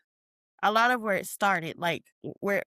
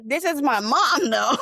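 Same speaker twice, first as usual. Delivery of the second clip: laughing; 6 semitones higher, 4 dB louder than usual. Real, not acted. Laughing while retelling.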